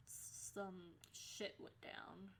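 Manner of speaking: usual speaking voice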